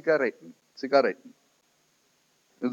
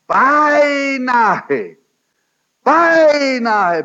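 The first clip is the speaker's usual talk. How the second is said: dramatic cry; 10 semitones higher, 7 dB louder, much slower than usual. Retold or imitated. Retold confession, dramatic peak.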